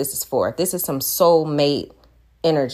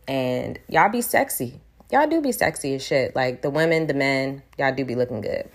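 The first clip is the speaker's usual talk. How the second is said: ordinary delivery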